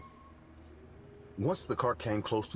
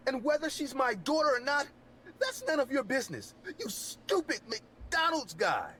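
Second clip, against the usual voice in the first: angry high MJ voice. Acted. Man angrily insists on name.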